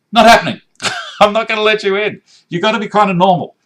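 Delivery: a playful fake bouncer turning him away; mock refusal. Jokingly refuses paranormal fan.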